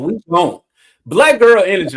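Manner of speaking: sassy lioness telling Scar off, lightly hammed; sassy Nala. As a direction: throw some attitude as Nala.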